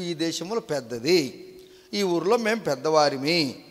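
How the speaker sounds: ordinary talk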